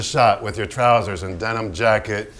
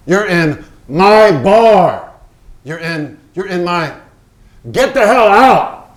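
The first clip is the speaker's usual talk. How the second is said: higher, louder, slow shout; furious; 6 semitones higher, 10 dB louder, slower than usual. Acted. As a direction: drive him out of the bar. Pollock throwing Hans out.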